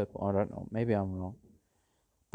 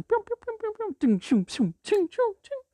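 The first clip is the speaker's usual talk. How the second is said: high pew pew laser shots; gunfire noises; 4 dB louder than usual. Retold or imitated. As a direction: make the gun sounds. Gun fire sound effects.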